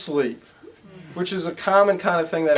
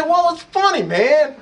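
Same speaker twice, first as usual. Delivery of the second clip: amused insistence; 9 semitones higher, 5 dB louder, slower than usual. Retold or imitated. Speaker insisting the story's funny.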